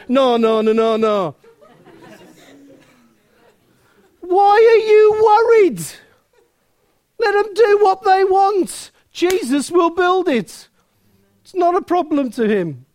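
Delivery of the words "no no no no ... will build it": fervent rebuke. Real, not acted. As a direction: rebuke the worry with fervour. Preacher rebukes worry passionately.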